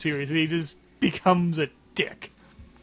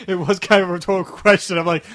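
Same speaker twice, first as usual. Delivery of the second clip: usual speaking voice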